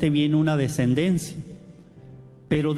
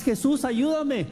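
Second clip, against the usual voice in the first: pleading cry; 8 semitones higher than usual. Retold or imitated. The blind man begging Jesus for help, high pleading cry. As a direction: beg him to help, reaching up. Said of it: "No nomás".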